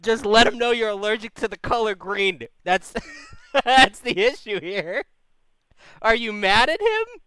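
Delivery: mock the silly problem; host ridicules caller's non-problem; incredulous. Real, not acted. Host mocking absurd problem.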